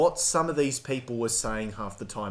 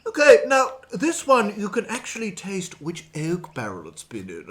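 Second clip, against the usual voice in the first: mock wine expert; 6 semitones higher, 3 dB louder than usual. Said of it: "and it's like, um".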